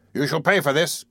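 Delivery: threat at normal pace, lifting at the end; first warning, held in check; angry threat. Acted. Man threatens the intruder, angry but contained.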